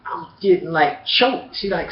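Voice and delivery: usual speaking voice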